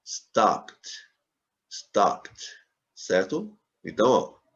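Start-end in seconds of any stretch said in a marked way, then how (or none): 0.1-2.6 Stopped as "stapt", each one set apart; correct one-syllable ending. Shown as a demonstration. Teacher drills the class, Stopped in one syllable. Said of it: "Ela não dobra na pronúncia".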